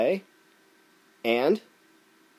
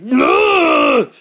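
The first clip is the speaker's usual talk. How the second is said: much higher and louder mock shouting; hammed-up rage; 12 dB louder than usual. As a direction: scream it like a cartoon hothead. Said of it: "the conservative gun nut would be like".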